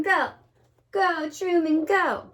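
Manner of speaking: ordinary delivery, nothing marked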